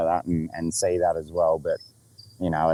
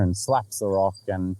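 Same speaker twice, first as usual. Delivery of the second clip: usual speaking voice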